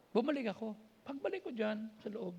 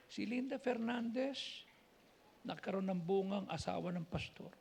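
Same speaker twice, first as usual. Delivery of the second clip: usual speaking voice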